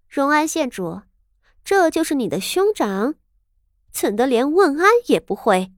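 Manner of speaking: high, cold disdainful princess; coldly. Acted. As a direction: ask with chilly contempt. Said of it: "声色微冷道".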